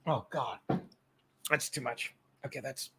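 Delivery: quiet strained mutter. Real, not acted. Reacting to strong shot.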